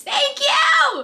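very high, excited squeal; squealed thanks. Real, not acted. Vlogger thanking friend for gifts, squealed thanks. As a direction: squeal your thanks in delight.

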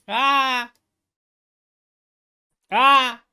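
short, high, loud "Ah!"; mock scream of panic; panicked cry. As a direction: yelp like someone fleeing. Acting out people running in panic.

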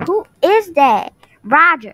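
loud, slow, suspicious demand. Acted. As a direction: call him out loudly. Girlfriend overhears, demands.

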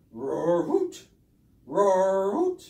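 roar turning to hoot. Pretend. Puppet owl trying to roar like a lion, failing. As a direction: start a roar, end in a squeaky hoot. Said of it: "keeps coming out as a hoot".

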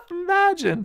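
high, laughing "Imagine"; giddy laugh on one word. Real, not acted. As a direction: breathe it out through a delighted laugh. Marvelling at temple meditation.

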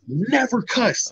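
"Never cussed" drawn out slowly, pitched high; high, slow stress on "Never cussed"; emphatic repeat. Real, not acted.

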